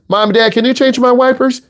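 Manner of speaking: put-on a teen begging parents to do it; whiny teen. Teen asking parents for help.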